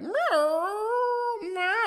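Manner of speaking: high squeaky whining Meow, meow like a whimpering puppy; mock a needy dog's whimper, way up high; imitated dog whine. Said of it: "whining a lot".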